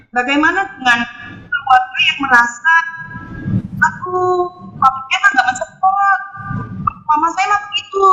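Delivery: audio dropping out on a call; broken, patchy connection voice; glitching robot. Real, not acted. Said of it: "Suara Ibu hilang-hilang".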